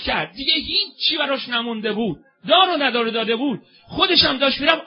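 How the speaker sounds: loud, high, crying-out sermon voice; fervent sermon lament. Acted. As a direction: call out to the crowd with rising fervour. Preacher's lament and exhortation.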